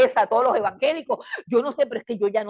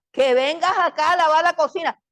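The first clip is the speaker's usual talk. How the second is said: loud, raised acted order; shouted parental command, higher pitched; exasperated parent calling; 4 semitones higher, 6 dB louder than usual. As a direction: call out sharply as a fed-up parent. Voices parent ordering child to kitchen.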